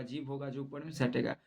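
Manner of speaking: ordinary talk, nothing marked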